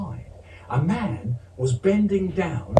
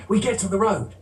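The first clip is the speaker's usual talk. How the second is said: plain reading voice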